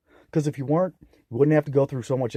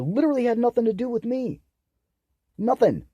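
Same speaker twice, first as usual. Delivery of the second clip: much higher, indignant pitch; real exasperated outburst; outraged; 9 semitones higher than usual. Real, not acted. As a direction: disbelief turning into anger. Outrage over buying van.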